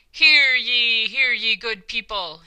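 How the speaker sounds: loud, bold sing-song town crier call; bold crier's call. Acted. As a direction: call out the crowd like a herald. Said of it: "he cried out in a bold and almost sing-song voice".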